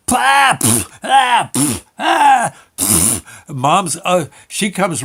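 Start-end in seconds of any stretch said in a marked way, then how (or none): 0.1-3.5 loud, high sputtering pah sounds; spitting noises. Retold or imitated. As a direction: spit it out noisily, much higher. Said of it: "screaming and yelling".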